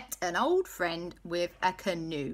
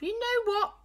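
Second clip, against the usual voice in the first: Auntie Lisa voice. Acted.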